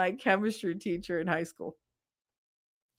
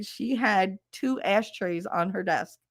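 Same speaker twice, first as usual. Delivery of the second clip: ordinary delivery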